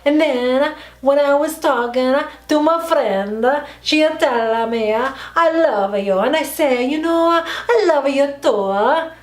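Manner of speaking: hammed-up Italian accent, slow and sing-song. Shown as a demonstration. Vlogger telling of her best friend, mock Italian accent. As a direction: stretch it out in a high Italian accent. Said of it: "I can do an Italian accent".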